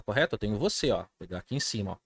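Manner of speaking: ordinary talk, nothing marked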